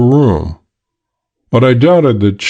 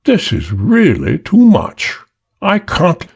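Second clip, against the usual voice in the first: slow, clipped-vowel British drawl with irritation; slow British upper-class drawl, fed up; exasperated British drawl; 8 semitones higher, slower than usual. Acted. The Chinese man scolding his gang in a British drawl. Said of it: "an edge of exasperation to the drawl".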